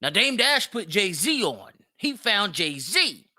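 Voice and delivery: usual speaking voice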